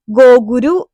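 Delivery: ഗോഗുരു said as go-gu-ru with an r sound, no l; teacher showing how a name changes; Gokul in Japanese. Shown as a demonstration. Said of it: "ഇതിനകത്ത് എൽ ഇല്ല".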